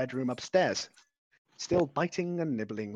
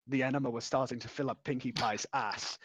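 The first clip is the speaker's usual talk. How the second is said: ordinary delivery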